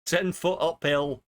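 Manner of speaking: slow, emphatic mock Yorkshire boast; mock Yorkshire old-timer. Streamer finishing the tall tale in slow mock Yorkshire. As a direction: drag it out like a tall-tale grandad.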